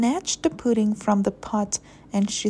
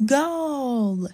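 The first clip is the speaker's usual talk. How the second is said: high, drawn-out cheer; little player's acted cry of triumph; celebratory shout; 5 semitones higher than usual. A narrator's reading. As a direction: shout it like a scorer. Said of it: "he shouted".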